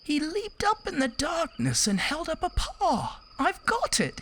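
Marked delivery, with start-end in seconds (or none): 3.4-4.2 much higher eager mouse voice; high squeaky mouse, delighted; excited mouse. Acted. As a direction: burst out with a tiny idea. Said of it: "he said".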